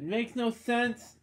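Makes no sense pitched high and drawn out slowly; high slow mock-exasperated Makes no sense. Real, not acted. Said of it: "This is the third time I said it".

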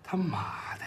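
hushed, breathless curse under the breath; whispered curse. Acted. Alone after hanging up, whispering a curse in disbelief. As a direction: mutter it almost soundless to yourself. Sange mutters to himself alone.

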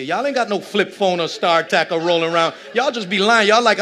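rising, loud pulpit call-out; preaching at full force. Acted. Pastor teasing his congregation, preaching loud. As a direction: preach it hard at the pews.

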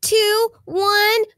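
drawn-out count to the start; measured fight countdown; slow countdown. Acted. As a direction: count them in slowly, spacing each number.

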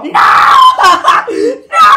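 high, loud, sobbing screams; hysterical screaming. Acted. Mother is pulled away from her daughter, hysterical screaming. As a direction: scream in bursts of grief.

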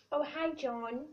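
ordinary delivery

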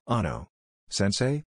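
plain reading voice

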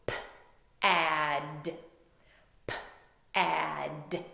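P-ad said as separate sounds, p then ad; sounds chopped apart. Shown as a demonstration. Teacher chopping P-ad apart for the class. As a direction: chop P-ad into its sounds. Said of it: "So chop it with me".

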